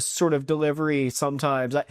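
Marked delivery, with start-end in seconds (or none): none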